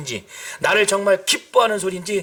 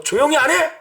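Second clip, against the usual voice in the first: rising shouted rebuke, louder and much higher on the repeat; crowd scolding; 10 semitones higher, 4 dB louder than usual. Retold or imitated. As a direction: bark it like an angry crowd silencing a beggar. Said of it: "막 이렇게 했을 때". (화난 군중의 높고 큰 호통)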